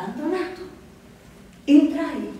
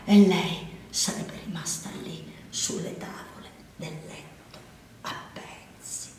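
horrified hush; 5 semitones lower, 5 dB quieter than usual. A narrator's reading. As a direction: let the voice collapse into breath. Climax collapses into horror.

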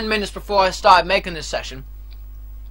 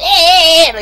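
put-on loud higher yelp copying the kid; imitated kid yelping; 7 semitones higher, 9 dB louder than usual. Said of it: "He just".

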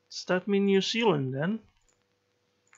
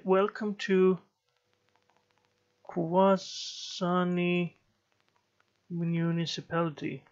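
drawn-out Kwasani, syllable by syllable, puzzling over the sign; slowly sounding out; slower than usual. Real, not acted. Reading a strange place name off a road sign, slow and unsure. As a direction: spell out Kwasani slowly as if reading it for the first time.

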